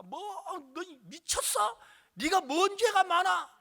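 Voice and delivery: high, drawn-out indignant retort; mother scolding. Retold or imitated. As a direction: imitate an outraged mother. Mother scolds child in story.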